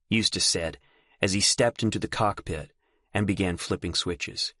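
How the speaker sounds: usual speaking voice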